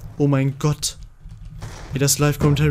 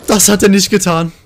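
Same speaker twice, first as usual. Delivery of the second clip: raised, higher-pitched cry of outrage; loud disbelief; 5 semitones higher, 8 dB louder than usual. Real, not acted. Player reacting to what the opponent did, outraged. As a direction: blurt it loudly, still reeling from the scream.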